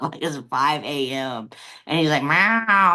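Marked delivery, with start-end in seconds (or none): 2.2-2.9 high, drawn-out cat meow; loud imitated meow. Cat waking owner at five a.m., loud meow. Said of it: "and it's so loud".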